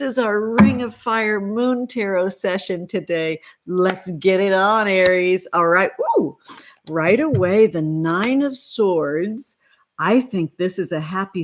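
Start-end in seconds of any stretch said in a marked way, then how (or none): 6.0-6.4 a sudden, much higher whoop; excited woo. Real, not acted. Host whooping to viewers as the reading starts, excited. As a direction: cheer it out like kicking off a party.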